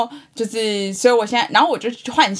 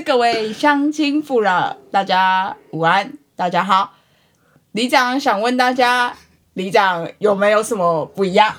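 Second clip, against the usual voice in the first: slow, drawn-out public address call; village chief broadcast; slower than usual. Retold or imitated. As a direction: drag out each greeting like a loudspeaker. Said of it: "他是".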